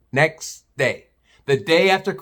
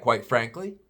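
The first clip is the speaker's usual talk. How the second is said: ordinary delivery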